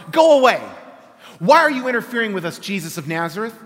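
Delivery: loud, high, frantic shout of a possessed man; screaming demon, fast and raw at the start. A narrator's reading. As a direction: hurl it at him like a cornered evil spirit.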